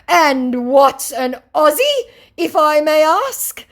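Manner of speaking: high, loud, slow mock question; mock scammer. Scammer pretending to be an Aussie, in high mock voice. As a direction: ham up his fake indignation. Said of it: "said my scammer".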